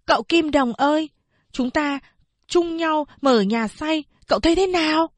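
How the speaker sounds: put-on a young boy pitching his idea; lightly voiced boy proposing a plan to his friend; boy Tư Mã Lương. A narrator's reading. Narrator voicing the boy.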